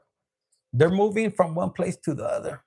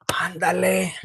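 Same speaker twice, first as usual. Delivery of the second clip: whispered urging. Real, not acted. Urging the sign along.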